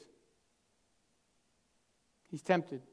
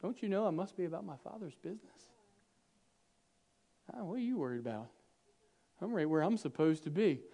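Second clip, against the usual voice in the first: calm reply; 7 dB quieter, faster than usual. Pretend. Youth Jesus calmly answering parents.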